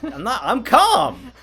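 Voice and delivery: high, slow, loud laughing protest; calm turning angry. Real, not acted. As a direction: insist you're calm, half yelling. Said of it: "Calm down".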